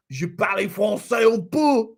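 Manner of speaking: exaggerated mock French accent. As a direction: belt out bad French with relish. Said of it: "I think you're French".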